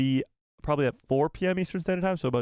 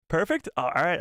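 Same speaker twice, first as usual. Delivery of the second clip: ordinary talk, nothing marked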